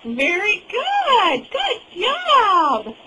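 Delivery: high, slow, delighted praise; excited praise. Real, not acted. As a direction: cheer the little one on. Praising toddler's answer.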